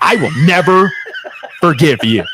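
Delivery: laughing mock shout; mock grudge. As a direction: fake outrage breaking into laughter.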